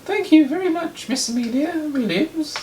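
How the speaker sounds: very high falsetto judge; put-on piping high voice for a judge; Rachel Lloyd. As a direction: address the court in loud falsetto. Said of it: "Rachel Lloyd".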